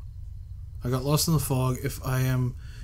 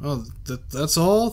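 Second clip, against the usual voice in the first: ordinary talk, nothing marked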